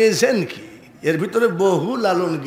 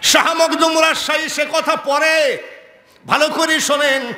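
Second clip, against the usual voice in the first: high-pitched proclamation; forceful preaching; 9 semitones higher, 3 dB louder than usual. Acted. Preacher telling the crowd to listen well, high forceful preaching. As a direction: ring it out to the whole gathering.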